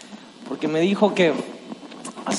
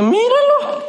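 mock amazement; slower than usual. Kid passing him in race.

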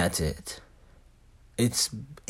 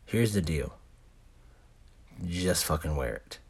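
usual speaking voice

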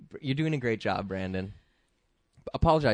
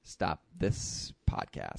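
whispered; 8 semitones lower, 7 dB quieter, much slower than usual. Real, not acted. Despairing aside after bad pun.

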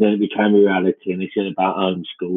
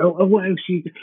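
put-on eager kid; 10 semitones higher, faster than usual. Friend quizzing the child.

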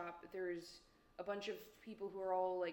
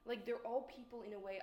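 normal talking voice

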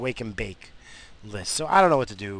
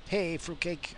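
weak Lobo imitation; 6 semitones higher, 5 dB quieter, slower than usual. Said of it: "I can't do it no more".

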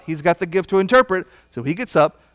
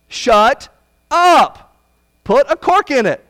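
a sharp, much higher, louder shut up; shouted order; 7 semitones higher, 5 dB louder, slower than usual. Acted. Preacher voicing Paul silencing the long-winded prophet, shouted. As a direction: bark the order so the room jumps. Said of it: "why I'm emphasizing it that way".

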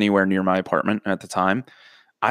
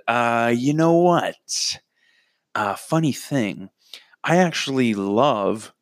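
fake casual lie; 3 semitones higher, much slower than usual. Making up a lie.

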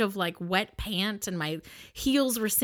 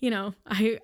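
ordinary delivery, nothing marked

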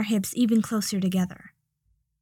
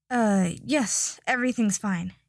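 usual speaking voice

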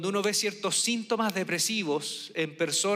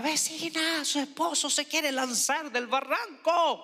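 calling neighbor; 8 semitones higher, slower than usual. Retold or imitated. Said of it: "le gritan a mi tía".

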